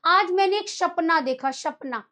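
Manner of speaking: सपना said as "शपना" with sh; mock the mistake: "शपना" for सपना. Teacher imitates students saying "शपना" with sh. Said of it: "बोलेगा".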